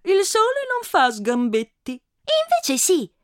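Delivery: put-on high, slow little girl voice. Little girl answers the adult back, high child voice. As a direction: play a stubborn small girl. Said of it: "aveva risposto la bambina".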